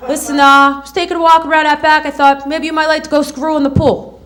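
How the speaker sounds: a light Brooklyn guy pickup voice; smooth-talking Brooklyn come-on, lightly done; Brooklyn accent. Pretend. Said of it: "Joey from Brooklyn".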